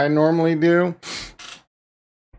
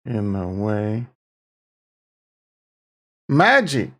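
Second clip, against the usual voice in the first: mystical announcer; slower than usual. Pretend. Mock mystical magic pronouncement.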